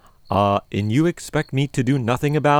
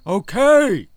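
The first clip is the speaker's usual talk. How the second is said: loud, higher yelp of surprise; startled shout; 9 semitones higher, 5 dB louder than usual. Acted. Man yelled at by aunt.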